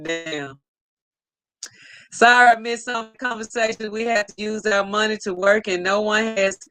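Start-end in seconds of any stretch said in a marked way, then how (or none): none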